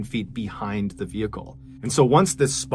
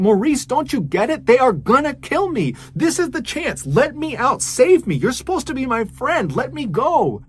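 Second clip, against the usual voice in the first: much higher, louder, frantic begging; desperate pleading; 12 semitones higher, 4 dB louder than usual. Retold or imitated. Captive girl begging her friend to free her, high desperate plea. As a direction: plead for your life, voice pitched way up. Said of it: "and so she's saying".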